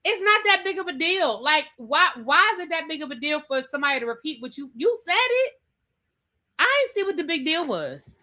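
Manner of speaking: high, fast, exasperated; can't believe the fuss. Real, not acted. Exasperated rant.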